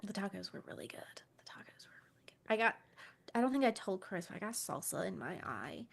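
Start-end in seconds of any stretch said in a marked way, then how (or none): 0.0-2.3 hushed whisper. Real, not acted. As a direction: breathe it with no voice. Whispered aside about tacos.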